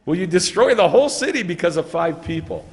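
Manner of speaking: disbelieving, insistent plea; pressing challenge. Acted. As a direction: shame him into agreeing. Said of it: "That's how he says it".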